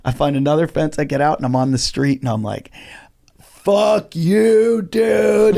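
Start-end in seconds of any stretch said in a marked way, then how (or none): 3.4-5.6 high, drawn-out mock yell; retold defiant yell. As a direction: stretch it out like a taunt flung from afar. Retold yell from the street after escaping.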